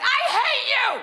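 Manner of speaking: high-pitched hammed-up yell; theatrical high screech; mock yelling. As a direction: yell it for the neighbors to hear. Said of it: "And I'd start yelling".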